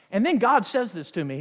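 usual speaking voice

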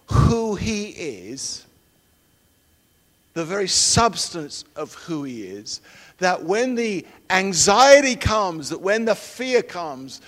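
fervent, loud sermon delivery; preaching. Acted. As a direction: preach it with full conviction. Preaching resumes.

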